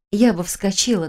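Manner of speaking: usual speaking voice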